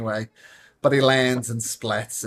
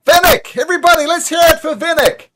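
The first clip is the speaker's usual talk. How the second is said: much higher and louder hype call to the crowd; announcer shout; 14 semitones higher, 10 dB louder than usual. Acted. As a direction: whip up the whole crowd for the hero. Host hypes the crowd.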